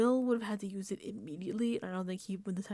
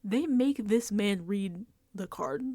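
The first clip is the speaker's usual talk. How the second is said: ordinary delivery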